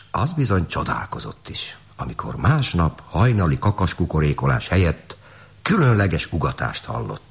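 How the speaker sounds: ordinary delivery, nothing marked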